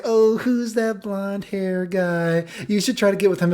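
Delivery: high, slow drawn-out put-on voice; imitated gushing mom. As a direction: coo with interest in a high mock voice.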